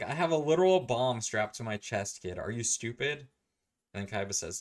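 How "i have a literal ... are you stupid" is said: loud, raised-pitch retort; incredulous acted outburst; mock exasperation. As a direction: berate the kid, hammed up.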